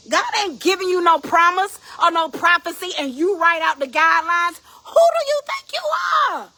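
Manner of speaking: high, loud preaching climbing to a shout; indignant rebuke. Acted. Preacher rebuking anyone who rewrites God's guidelines, indignant shout. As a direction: thunder it, outraged at such presumption. Acted rebuke of one bargaining with God.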